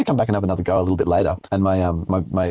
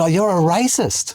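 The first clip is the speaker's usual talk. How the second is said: mock accusation; 7 semitones higher, much slower than usual. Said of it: "I said".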